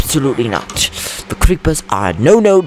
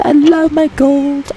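much higher, slow doting coo; high sing-song; 3 dB louder, slower than usual. Acted. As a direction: coo over the gold lovingly. Doting over gold.

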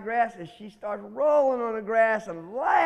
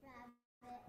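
dramatic whisper; 27 dB quieter than usual. A narrator's reading. Storyteller teasing the children with the answer, whispered. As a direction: breathe the name in a hush. Storyteller reveals who showed up.

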